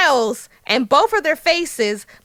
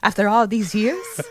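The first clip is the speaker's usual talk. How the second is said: dramatic speech in a put-on British accent; 3 semitones lower than usual. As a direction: play the bitter grievance in an affected British accent. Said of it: "Let me speak in an accent".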